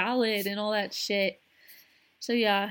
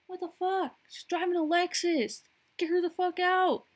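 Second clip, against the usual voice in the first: mock neighbor freaking out; 8 semitones higher than usual. Neighbor calling the cops on a stranger, high mock panic. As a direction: shriek it like a scandalized homeowner. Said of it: "They're like".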